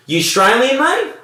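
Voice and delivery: put-on high, rising old-Aussie question; old bloke quizzing, voice swooping upward. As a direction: imitate a nosy old Aussie, pitch leaping up on "mate".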